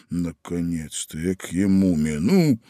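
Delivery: usual speaking voice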